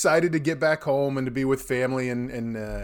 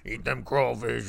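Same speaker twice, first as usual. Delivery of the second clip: slow, quieter Cajun caricature; Coach O; 5 dB quieter, slower than usual. Pretend. Host parodies a Louisiana coach, slow Cajun drawl. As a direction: drawl it out in mock bayou talk. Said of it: "trying to play for Coach O".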